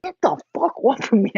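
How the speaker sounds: high, loud angry yell. Retold or imitated. Telling the boyfriend to get off, loud yell. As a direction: shove him off with your voice. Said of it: "I yelled at him".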